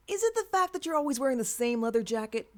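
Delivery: playful jab; teasing. Acted. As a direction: needle him about his jacket.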